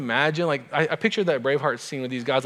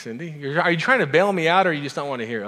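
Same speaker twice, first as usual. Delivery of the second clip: ordinary delivery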